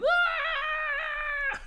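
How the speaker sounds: high, loud battle yell; high shout. Retold or imitated. Kevin Nash running in with a wild shout. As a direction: let out a sudden charging scream.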